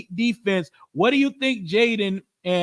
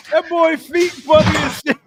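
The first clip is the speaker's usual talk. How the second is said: laughing shout; 8 semitones higher, 7 dB louder than usual. Real, not acted.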